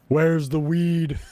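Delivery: slow, loud stoner drawl; goofy stoner voice. Pretend. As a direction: drag it out like a stoner.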